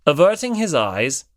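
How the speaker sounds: plain reading voice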